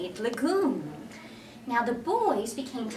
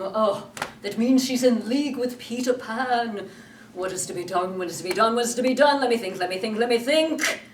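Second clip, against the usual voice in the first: loud, building panic; captain panicking over the news; fretting Hook; 5 dB louder than usual. Acted. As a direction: pace and mutter louder.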